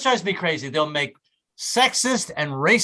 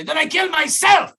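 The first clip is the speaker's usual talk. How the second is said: high, loud, slow shout in a hammed-up Hungarian accent; angry Hungarian boss; 12 semitones higher, 6 dB louder than usual. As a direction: threaten like an irate Hungarian employer. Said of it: "a guy who was Hungarian".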